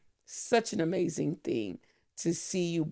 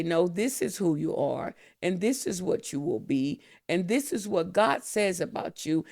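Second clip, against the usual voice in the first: prophetic declaration; 3 semitones higher, 3 dB louder than usual. Retold or imitated. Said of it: "him to say".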